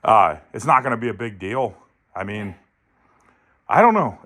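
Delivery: ordinary delivery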